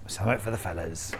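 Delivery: low, soft, hurried gruff bloke; mock-gruff pool player, kept low and quiet; gruff put-on voice. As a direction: drop right down into a quick gruff voice. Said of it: "I said I'd do the voice".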